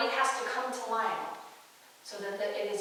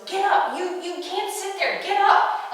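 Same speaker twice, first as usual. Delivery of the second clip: high, loud outburst with a stammer; enraged yell; 9 semitones higher, 9 dB louder than usual. Retold or imitated. Birdie turns on Lionel in the coronation chair, enraged yell. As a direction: yell it in outrage, tripping on the start. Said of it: "and enraged yells".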